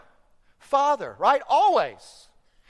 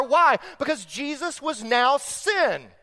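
normal talking voice

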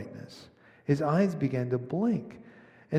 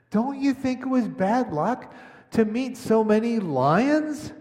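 put-on higher, louder boy's voice, puzzled; a young boy protesting, pitched up; boy Shasta; 9 semitones higher, 5 dB louder than usual. A narrator's reading. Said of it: "said Shasta".

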